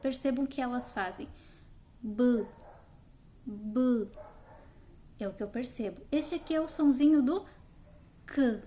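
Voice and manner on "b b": B with a vowel attached, as mothers say it; B stretched with an added vowel; B said the wrong way, with a vowel after it; mothers' drawn B. Shown as a demonstration. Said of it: "o que muitas mães estão fazendo".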